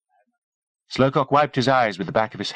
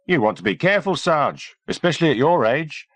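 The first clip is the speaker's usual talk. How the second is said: higher-pitched cheeky lad; higher, teasing squaddie voice; young soldier Feely; 9 semitones higher than usual. A narrator's reading. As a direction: tease the sergeant in a higher voice. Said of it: "came Feely's voice from close by".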